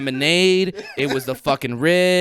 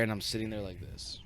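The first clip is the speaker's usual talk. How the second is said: usual speaking voice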